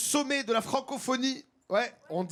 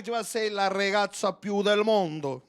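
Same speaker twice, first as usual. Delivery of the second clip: a light mock Italian accent, flirting; mock Italian accent, hammed-up flirting. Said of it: "L'italien, il est beau gosse, il drague".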